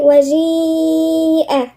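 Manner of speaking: وجيء with a very long "ee", then hamza; connected madd. Shown as a demonstration. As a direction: stretch the vowel of وجيء before the glottal stop. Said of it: "يبقى هننطقها ازاي؟". (مد الياء في "وجيء")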